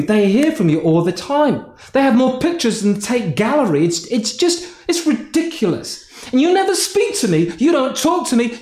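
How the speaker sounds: exasperated rant at God, pitched high; fed-up complaint. Retold or imitated.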